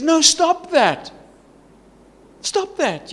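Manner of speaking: high, forceful acted command, drawn out at first; firm protest. Peacemaker to people harming one another, firm protest. Believer's inner protest against fighting.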